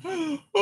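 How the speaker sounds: a big real yawn, sharp gasp in. Real, not acted. Caught by a contagious yawn, gasping yawn. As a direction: caught by a yawn mid-sentence. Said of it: "you, oh, yawning".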